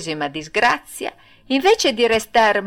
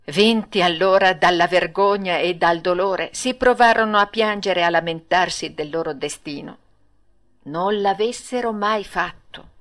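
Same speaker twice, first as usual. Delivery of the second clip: plain reading voice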